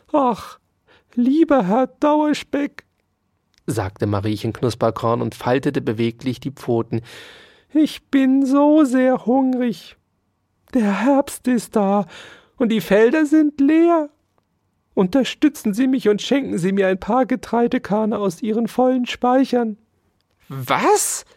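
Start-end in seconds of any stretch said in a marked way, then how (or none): 0.1-2.8 high, slow, beseeching mouse voice; pleading mouse. A narrator's reading. Mouse begs lieber Herr Dauerspeck for help, pleading. 7.7-19.8 high, pitiful mouse begging; pleading mouse. A narrator's reading. Hungry mouse begs Dauerspeck for grain, pleading. 20.5-21.2 short outraged exclamation; furious cry. A narrator's reading. Dauerspeck hears of his full stores, furious. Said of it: "schrie Ambrosius Dauerspeck wütend".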